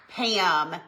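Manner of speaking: usual speaking voice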